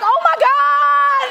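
loud, high, drawn-out gasp of shock; hammed-up shocked viewer. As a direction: stretch it out like a soap fan at a huge twist. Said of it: "and you're like".

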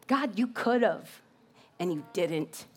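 slow, soft, sinking accusation to God; hurt reproach. Acted. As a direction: let it drop low and quiet on the second half. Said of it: "Have you felt like her?".

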